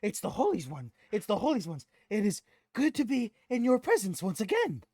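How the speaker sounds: high, loud, reverent goblin; Gob worshipping. Acted. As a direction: gush like a goblin before his idol. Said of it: "worships Tyrael".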